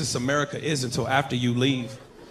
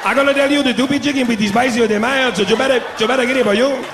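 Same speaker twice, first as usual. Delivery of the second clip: put-on thick Mexican accent; 7 semitones higher, 4 dB louder than usual. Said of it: "He kept looking at the menu".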